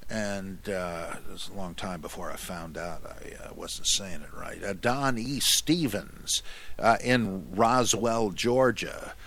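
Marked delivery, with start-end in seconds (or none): none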